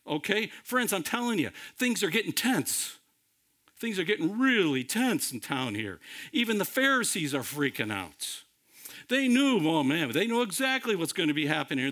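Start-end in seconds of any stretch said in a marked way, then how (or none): none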